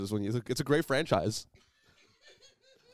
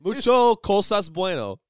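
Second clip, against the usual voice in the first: mock Spanish accent; 7 semitones higher, 9 dB louder, slower than usual. Joking Spanish phrase.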